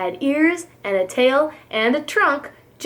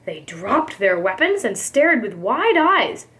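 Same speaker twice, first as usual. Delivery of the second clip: ordinary delivery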